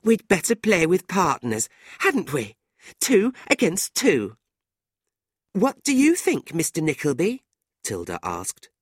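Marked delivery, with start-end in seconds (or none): 0.0-4.3 high, louder, insistent hinting; pointed young woman. A narrator's reading. Fanny angling for Nicholas as card partner, pointed. 5.5-7.5 very high, playful question; coquettish young woman. A narrator's reading. Tilda inviting Nicholas's opinion on partners, coquettish.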